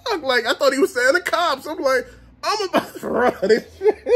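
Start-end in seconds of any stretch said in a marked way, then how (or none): 0.0-0.2 high-pitched, baffled outburst; startled disbelief. Retold or imitated. Hearing friend scream about ops, startled disbelief. 2.4-4.1 high, cracking up mid-line; laughing mock panic. Thinking the cops are coming, laughing mock panic.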